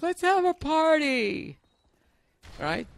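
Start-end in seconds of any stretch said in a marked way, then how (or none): 0.0-1.6 high, slow girly impression of his wife; wife's voice. Comic telling his wife's party idea, high voice. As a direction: mimic wife's excited high voice. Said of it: "So she's like".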